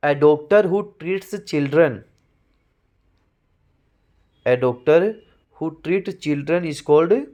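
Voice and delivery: slow, deliberate reading of a definition; slow dictation. Shown as a demonstration. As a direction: read it slowly so students can write it down. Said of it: "dictates definition".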